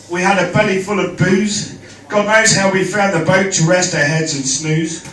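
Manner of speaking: ordinary talk, nothing marked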